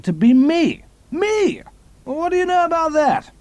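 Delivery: indignant, pitch shooting up on "Me"; outraged disbelief. Acted. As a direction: voice leaps high in indignation. Indignant spy, outraged at imposter.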